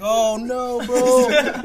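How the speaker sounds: loud, high mock dismay; hammed-up wail of alarm; freaked out. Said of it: "here's my second freak out".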